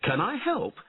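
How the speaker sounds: high-pitched, exclaiming call; lively high character voice; knight calling out. A narrator's reading.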